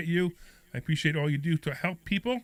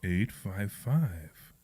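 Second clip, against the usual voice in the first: very slow, low seductive read; sexy voice; 8 semitones lower, 5 dB quieter, much slower than usual. Shown as a demonstration. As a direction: drawl the number out seductively. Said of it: "I could do it sexier".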